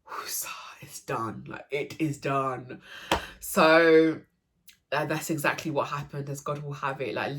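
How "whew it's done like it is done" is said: whispered slowly under the breath, relief; relieved whisper. Retold or imitated. Mother at the birth, to herself, slow relieved whisper. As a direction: breathe it out like the weight just lifted. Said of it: "and to just be like".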